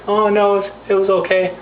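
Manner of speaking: high, hesitant, timid reassurance. Acted. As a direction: imitate a nervous singer brushing it off. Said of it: "[name]'s all scared now".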